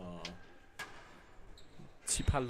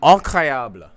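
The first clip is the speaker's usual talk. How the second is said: excited; 4 semitones higher, 17 dB louder, slower than usual. Real, not acted. Cheering a great point.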